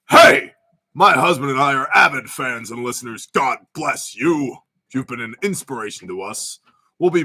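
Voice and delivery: reading her message in a lightly put-on voice; playfully voicing the viewer's comment; put-on Kate voice. Host reads Kate's question in a put-on voice. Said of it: "but I'm gonna go this way".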